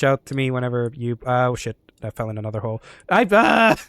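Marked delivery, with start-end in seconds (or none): 3.3-3.8 sudden high loud yelp; startled yelp. Real, not acted. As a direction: cry out as you fall. Falls into another hole.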